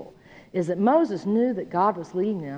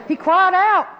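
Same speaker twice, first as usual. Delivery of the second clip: CRIED OUT pushed high and loud; "out" drawn long and loud; emphatic cry; 9 semitones higher, 6 dB louder, slower than usual. Shown as a demonstration. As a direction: hit "cried out" with raised pitch and volume. Stressing the verse's strong verb.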